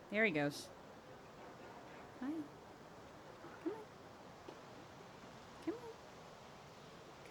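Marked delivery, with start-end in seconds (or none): 2.2-7.3 coaxing whisper. Real, not acted. Coaxing cat, breathy whisper.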